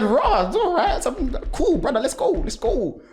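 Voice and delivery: high, loud, laughing pep talk; psyching himself up before the race; laughing hype. Retold or imitated. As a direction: pump yourself up, laughing. Hyping himself in the heat.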